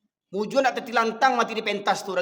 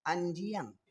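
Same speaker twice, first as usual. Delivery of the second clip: low, soft and held back; softened insult; 4 semitones lower, 9 dB quieter than usual. Shown as a demonstration. Preacher softens his teaching for congregation, mock-gentle. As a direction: drop suddenly from shouting to a soft voice. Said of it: "selalu nak kau gaji kau".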